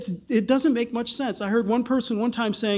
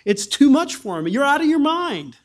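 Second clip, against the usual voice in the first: higher, louder outburst; screams out; 6 semitones higher, 6 dB louder than usual. Acted. Festus scolding Paul in court, a loud outburst. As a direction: shout him down. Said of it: "Festus then screams out".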